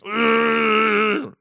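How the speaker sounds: loud high frustrated grunt; kid's angry grunt in his dad's face. Retold or imitated. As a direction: grunt at dad like a cranky kid. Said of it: "He's like".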